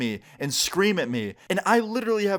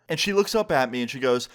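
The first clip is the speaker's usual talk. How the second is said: normal talking voice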